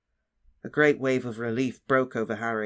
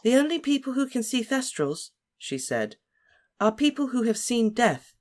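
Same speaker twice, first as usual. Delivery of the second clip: much higher know-it-all schoolgirl; earnest Hermione; 10 semitones higher than usual. A narrator's reading. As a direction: answer like the top pupil. Hermione voiced.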